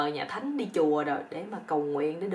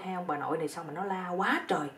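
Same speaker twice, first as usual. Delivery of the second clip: ordinary delivery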